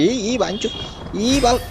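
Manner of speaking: panicked, high-pitched yelling; urgent calls to pull down; frantic high shouting. Real, not acted. Frantic calls during parachute drop.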